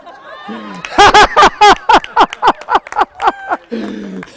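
loud, high burst of laughter; hearty laugh. Real, not acted. Laughs at his own joke.

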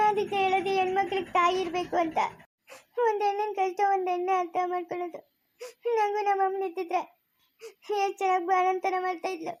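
softly crying through the words; weepy and subdued; tearful lament. Acted. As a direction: lament quietly to yourself, close to sobbing. New mother weeps alone, missing mother.

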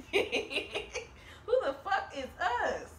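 slow, laughing through the retort; laughing repeat. Retold or imitated. Reader repeating the Sagittarius quote, laughing. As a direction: giggle out the line again.

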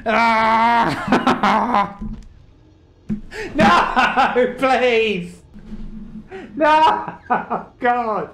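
high laughing screams of frustration; raging gamer. Real, not acted. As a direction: shriek and laugh at the game.

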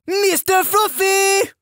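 very high, drawn-out mock yell; sergeant yelling out. Sergeant spotting fuzz, high summoning yell. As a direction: call it out long and high, French-Canadian style. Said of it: "she would yell out".

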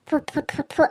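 short repeated spitting puffs; ritual puffs. Acted. Warding the evil eye off a baby with ritual puffs. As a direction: puff out quick mock spits.

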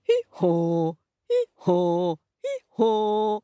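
proud donkey hee-haw; donkey bray. Acted. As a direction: bray it out, each haw held. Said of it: "such a fine voice".

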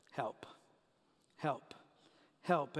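soft, sparse plea; praying for help. Acted. Patients in the infusion lab praying, quiet plea. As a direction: let each plea fall quietly, with pauses. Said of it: "you're all praying that same prayer".

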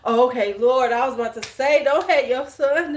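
high and loud, amused relief; relieved joking. Real, not acted. To the viewer about her son, loud amused relief.

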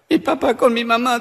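voz fingida de nena, algo aguda; niña llorosa. Acted. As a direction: imita a una chiquita lloriqueando. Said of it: "Estaba una chiquita llorando". (niña llorando, en broma)

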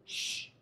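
soft, hushed shush; a quiet "shh" to still a storm; one gentle hush. Shown as a demonstration. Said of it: "it's more likely that he did this".

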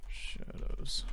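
soft unvoiced whisper. Real, not acted. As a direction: breathe the word out. Reads title softly.

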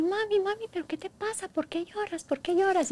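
put-on high, soft child's voice asking; a small daughter worried for her mom; sweet little girl's voice. Mother imitating her sick little daughter, high and soft. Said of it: "Y su vocecita dulce, tierna".

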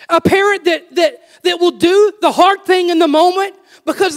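high, loud pulpit preaching; fired-up sermon delivery; preaching at full force. Acted. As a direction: preach it hard to the congregation. Preacher driving his point home.

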